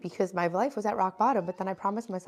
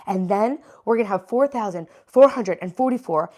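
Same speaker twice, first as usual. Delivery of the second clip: usual speaking voice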